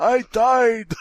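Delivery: put-on high old man voice; old-timer voice. Friend continuing the grandpa bit, high and frail.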